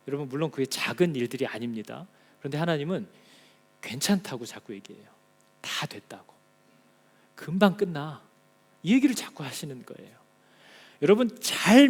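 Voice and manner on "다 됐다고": soft unvoiced whisper. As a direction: breathe it gently as a reassurance. God's reassurance acted out.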